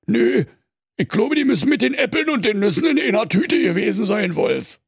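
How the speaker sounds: very high, rambling bear voice; dopey bear. A narrator's reading. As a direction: bear babbling excuses. Bear fumbling again.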